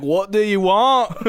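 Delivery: loud, high, slow holler back; mock annoyed yell. Fallen friend answering from the hole, mock annoyed yell. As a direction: jokingly shout up as the fallen friend.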